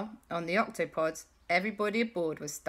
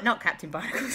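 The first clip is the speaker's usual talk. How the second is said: ordinary delivery, nothing marked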